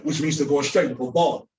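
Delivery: normal talking voice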